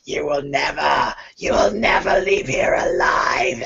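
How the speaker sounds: high, rising whispered threat building to a shout; menacing skull. Acted. GM's undead skull threatens the party, building to a scream. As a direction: hiss then scream the threat.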